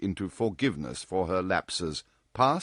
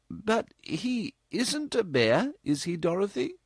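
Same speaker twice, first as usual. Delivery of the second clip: worried mother; 14 semitones higher than usual. A narrator's reading. Mother anxiously asks her daughter about the man, worried. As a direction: ask hoping to hear no.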